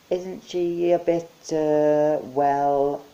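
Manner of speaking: high, slow, unsure; hesitant Mike. Acted. Mike awkwardly asking about the witch picture. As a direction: search for a polite word.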